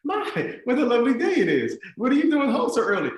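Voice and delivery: very high, loud, overdone politeness; nervous cheeriness. Retold or imitated. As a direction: stall with hammed-up nervous small talk. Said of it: "I'm nervous".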